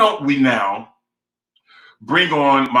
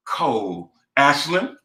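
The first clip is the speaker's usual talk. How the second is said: ordinary talk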